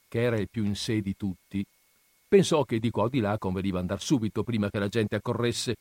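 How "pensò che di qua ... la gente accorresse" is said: ordinary delivery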